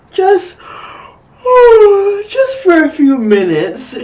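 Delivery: reasoning sleepily. Acted. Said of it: "she reasoned".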